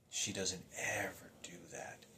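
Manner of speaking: soft unvoiced whisper, slow; hushed, stunned repeat. Real, not acted. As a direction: breathe the line out in a whisper. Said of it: "and she doesn't ever do that".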